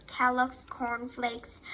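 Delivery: plain reading voice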